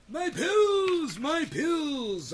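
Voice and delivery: loud high frantic cry for pills; mock panic. Mock panicked man shouting for his pills, loud and high. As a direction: wail it like a desperate old man.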